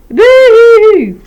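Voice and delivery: loud, high hammed-up boo-hoo; mock wailing sob. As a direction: overdo the crying on purpose. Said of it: "floods of tears".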